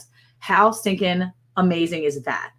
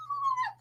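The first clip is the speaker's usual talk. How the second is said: squeaky laugh; 10 dB quieter than usual. Retold or imitated. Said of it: "and I'm like".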